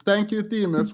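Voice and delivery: normal talking voice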